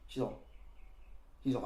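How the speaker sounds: ordinary delivery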